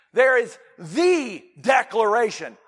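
THE held long before "declaration"; slow, emphatic THE; stressed THE. Shown as a demonstration. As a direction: proclaim THE as the only one. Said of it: "the emphasis on this".

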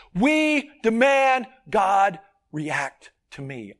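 slow, weighty stress on demanded; slow emphatic point. Real, not acted. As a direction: lean on demanded, drawing each word out. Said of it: "slow emphatic sermon point".

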